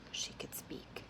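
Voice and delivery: breathy whispered repeat. A narrator's reading. Narrator echoing her only option, in a whisper. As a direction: hush it to a whisper.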